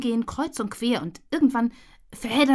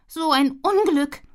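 lament; 5 semitones higher, 4 dB louder, slower than usual. Acted. Tilly laments.

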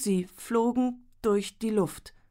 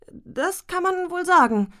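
much higher and louder, slowly; stunned exclamation; 10 semitones higher, 6 dB louder than usual. Acted. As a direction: blurt it out, overwhelmed and slow. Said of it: "sich jetzt gerade so weit zusammenriss". (überwältigt, hoch und laut)

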